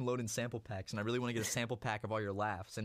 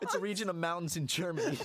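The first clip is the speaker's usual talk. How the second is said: higher voice, laughing through it; 5 semitones higher than usual. Pretend. Explaining fake mountains to co-host, laughing. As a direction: explain it while cracking up.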